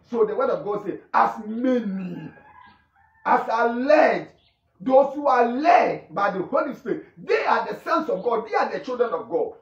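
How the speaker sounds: emphatic, declamatory sermon delivery; forceful preaching. Real, not acted. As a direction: preach it with conviction, driving each phrase home.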